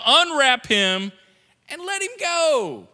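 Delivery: slow, loud order rising at the end; firm command. Retold or imitated. Jesus telling them to free Lazarus, slow command. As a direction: order them slowly with authority.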